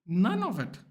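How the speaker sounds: high, sharp telling-off with a swear; defiant retort. Retold or imitated. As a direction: snap back that it's none of their business. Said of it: "I'm like".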